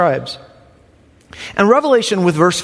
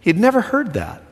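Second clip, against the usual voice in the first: ordinary talk, nothing marked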